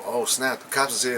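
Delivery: normal talking voice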